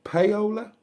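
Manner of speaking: "Paola" as pay-OH-luh, high and questioning; tentative name attempt. Shown as a demonstration. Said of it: "Is that right".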